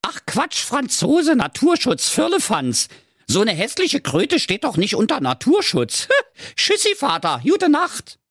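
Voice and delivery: very high, pleased princess; high-pitched triumphant daughter; delighted. A narrator's reading. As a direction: dismiss it gleefully in a high character voice. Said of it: "rief erfreut".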